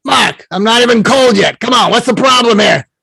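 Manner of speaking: irritated Brooklyn grouch, high and loud; Larry King, Brooklyn accent. Acted. Late mentor complains at being woken, cranky Brooklyn accent. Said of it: "he says, in his Brooklyn accent".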